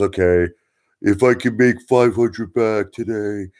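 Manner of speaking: a half-hearted cartoon detective bragging about small wins; Detective Pikachu impression. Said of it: "like that Detective Pikachu".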